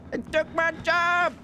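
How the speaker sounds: high, strained cartoon voice; squeaky South Park imitation. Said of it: "That's how you sound like".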